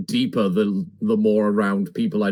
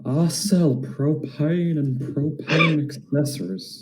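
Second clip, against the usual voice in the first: Hank Hill impression; 4 semitones higher, slower than usual. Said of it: "drifting into Hank Hill".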